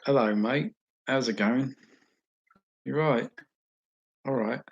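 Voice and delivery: loud, high, matey English greeting; English accent. Shown as a demonstration. Streamer showing off accents, chummy English accent. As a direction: hail a mate in a bold English accent.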